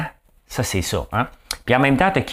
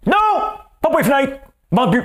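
much higher, louder mock cry; mock realtor refusal; 3 dB louder than usual. Realtor refuses the buyer's window request, mock high cry. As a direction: shriek it like a hammed-up, indignant seller.

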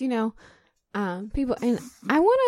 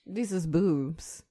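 Florida answer; 5 semitones lower, 8 dB quieter, much slower than usual. Real, not acted. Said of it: "the most Florida answer".

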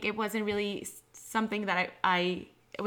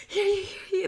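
imitating a winded, high-pitched greeting; mock breathless call, pitched way up; out of breath; 11 semitones higher than usual. Former host imitating herself running between tables, mock out of breath. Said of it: "we'll be running out of breath".

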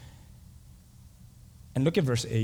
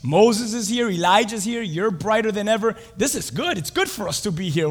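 louder, higher, eager exclamations; excited Peter; 7 semitones higher, 5 dB louder than usual. Acted. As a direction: gush like an overeager disciple. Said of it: "He's like".

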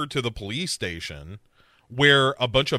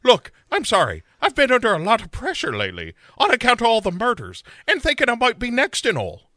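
higher, fast mock Cockney apology; bad Cockney accent; 8 semitones higher, 4 dB louder, faster than usual. [name] apologizing to Dylan, bad Cockney accent.

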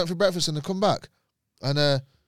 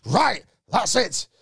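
higher, louder mock-pompous outrage; outraged owner; 6 semitones higher, 5 dB louder than usual. Hotel owner banning swearing, outraged mock telling-off. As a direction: lay down the law, raised and emphatic.

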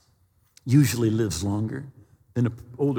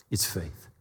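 soft intense; 8 semitones lower, 10 dB quieter than usual. Real, not acted. Sermon climax on faith.

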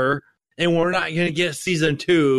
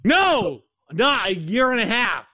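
impatient protest; 8 semitones higher, slower than usual. Real, not acted. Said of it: "You're not a very patient person".

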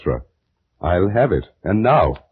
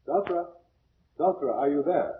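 calling from afar; 9 semitones higher, 8 dB quieter than usual. Acted. Priest calls from outside.

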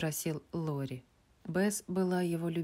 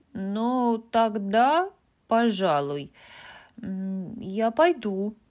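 slowly; 5 semitones higher, 7 dB louder, much slower than usual. A narrator's reading. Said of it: "сказала Эми медленно".

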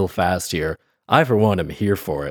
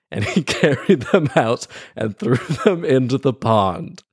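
laughing; 4 semitones higher than usual. Real, not acted. Laughing at the absurd killing.

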